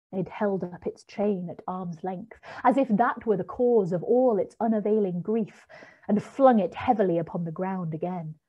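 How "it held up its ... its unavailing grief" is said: usual speaking voice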